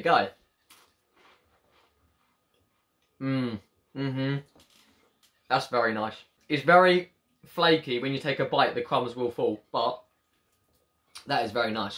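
talking while chewing wafer; mouth full, chewing. Real, not acted. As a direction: hand covering mouth. Seen 1: Bites wafer at -3, chews with hand over mouth throughout.

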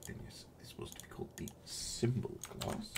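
unvoiced whispered aside. Real, not acted. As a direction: whisper it like a secret. Whispered aside about class name.

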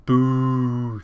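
loud, long held jeer; drawn-out boo. Pretend. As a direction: boo the referee in one long note. Jeering the referee's decision.

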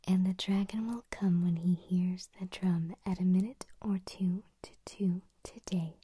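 loud stage whisper, every consonant crisp; crisp whispered tongue twister. Shown as a demonstration. ASMR host whispering a tongue twister to viewers, crisp and loud. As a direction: whisper it hard, spitting out each t.

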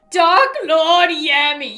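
loud, slow, theatrical proclamation; ominous villain title. Pretend. Player naming the new villain, slow mock-ominous boom.

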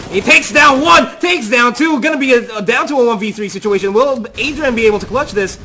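loud, high hype play-by-play; esports caster. Real, not acted. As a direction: call the clutch at full excitement. Live clutch-round play-by-play.

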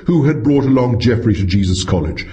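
usual speaking voice

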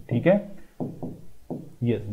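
ordinary talk

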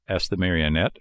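plain reading voice

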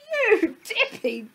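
very high, loud, slow jeer; mock with squeaky drawn-out glee; shrieky amused bird; sniggering tease. A narrator's reading. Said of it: "she sniggered".

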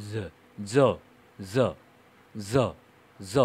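"জ" as a buzzed English z; voiced z demo. Shown as a demonstration. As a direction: repeat short, separate "জ" with a z buzz. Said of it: "সেটা হচ্ছে voiced".

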